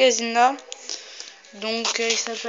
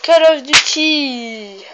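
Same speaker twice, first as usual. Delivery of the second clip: slow, loud movie-trailer voice on Call of Duty; mock trailer announcer; 3 semitones higher, 8 dB louder, much slower than usual. Reads game title dramatically.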